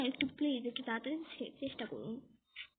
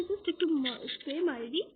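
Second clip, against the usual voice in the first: ordinary talk, nothing marked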